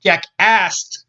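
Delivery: "asked" slowly enunciated, every consonant sounded; careful "asked". Shown as a demonstration. Reader correcting a slip, "asked" said slowly and distinctly. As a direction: spell out the k and t in "asked". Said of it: "as we asked, not asked".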